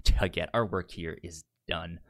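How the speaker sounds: normal talking voice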